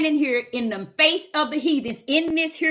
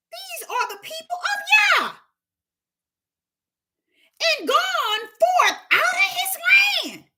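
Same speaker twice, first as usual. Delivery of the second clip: astonished heathen. Acted. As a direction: gasp it out like a stunned outsider. Heathens recognize the people of Yah.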